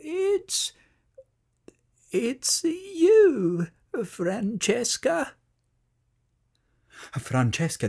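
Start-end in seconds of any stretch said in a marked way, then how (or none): 0.0-5.3 tender halting words to a friend; kind whisper. A narrator's reading. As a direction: break the news kindly. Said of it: "with his kind voice whispered".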